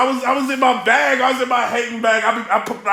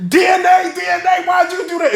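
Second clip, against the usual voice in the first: screaming at the TV; 7 semitones higher than usual. Retold or imitated. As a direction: yell at the rapper like he can hear you. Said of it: "I'm screaming at it".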